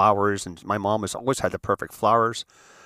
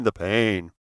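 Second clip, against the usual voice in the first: melodramatic moan. Retold or imitated. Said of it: "Very melodramatic".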